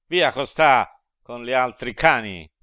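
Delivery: loud, harsh rebuke; driving him off. A narrator's reading. Virgil driving off the sinner, loud scornful rebuke. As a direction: shove him off with the words. Master shoves the sinner away.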